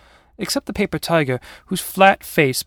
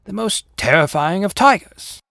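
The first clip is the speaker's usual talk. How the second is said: higher, proud tiger boast; moderately raised voice of an indignant tiger; mild yell; 6 semitones higher, 4 dB louder than usual. Acted. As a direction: proclaim it with a modest yell. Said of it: "yelled the paper tiger".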